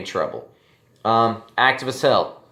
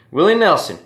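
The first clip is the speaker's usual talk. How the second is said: much higher and louder, a hammed-up twang on the name; 8 semitones higher, 6 dB louder than usual. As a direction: belt the name out like the country singer. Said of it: "gives me an opportunity to talk like this".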